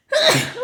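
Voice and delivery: a loud, sudden real sneeze; sneezing. Real, not acted. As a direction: let out one sharp sneeze. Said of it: "Oh, je vais éternuer". (éternuement soudain et fort)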